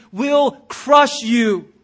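slow, loud, heavy shout of warning; preacher's peak, hammering it home. Real, not acted. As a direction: bear down hard on crush. Preacher climaxing his warning.

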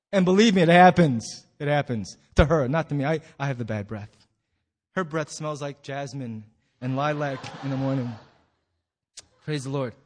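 ordinary talk, nothing marked

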